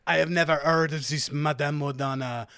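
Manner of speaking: put-on theatrical French-accented shopkeeper. Kiosk seller to adventurer about Madame, French accent. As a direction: answer as a hammy French stallholder. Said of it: "I will do this accent".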